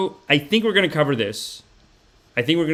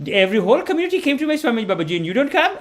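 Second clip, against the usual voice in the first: aunt voice; 12 semitones higher, 4 dB louder, faster than usual. Retold or imitated. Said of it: "She's like".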